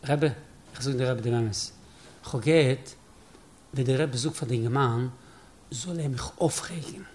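low, soft admission to the rebbe; hushed confession. Acted. Chassid confesses what he overheard. (שטילע הודאה)

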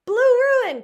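short, punched exclamation; crying out. A narrator's reading. Bacteriologist cries out on remembering, sudden exclamation. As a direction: cry it out, broken between the two words. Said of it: "cried the bacteriologist".

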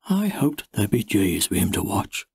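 ordinary delivery, nothing marked

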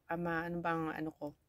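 ordinary talk

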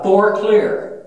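mild imitated yell. Gun captain signalling the barrel is safe, mock yell. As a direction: call it out like a restrained crew shout. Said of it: "he yells out".

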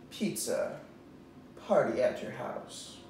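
ordinary delivery

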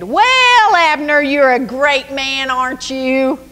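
high mocking jab; David mocking Abner from a safe distance, taunting. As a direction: sneer up at him, teasing. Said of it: "David taunted".

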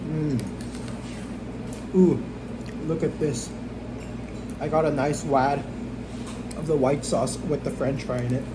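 talking between bites; muffled words while eating; mouth full while chewing. Real, not acted. Seen 3: Bites at -3 and 8.8; lips closed, chewing while speaking.

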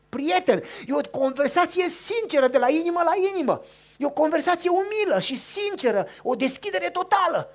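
loud, high preacher's voice; fervent sermon climax; preaching at full force. Acted. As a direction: proclaim it to the hall.

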